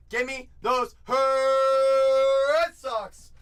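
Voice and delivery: slow, drawn-out loud demand; demanding shout. Retold or imitated. Buyer Pack calling for his team, slow shout. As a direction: bellow it like a greedy buyer. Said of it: "Pack said".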